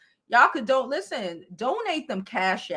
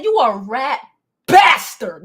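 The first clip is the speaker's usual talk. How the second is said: mock angry, punching bastard loud; retold insult; 9 dB louder than usual. Telling the dude off at the party, mock angry. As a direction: hit bastard hard and loud. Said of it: "This is what I said to him".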